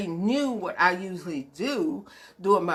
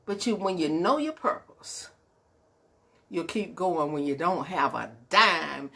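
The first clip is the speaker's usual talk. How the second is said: usual speaking voice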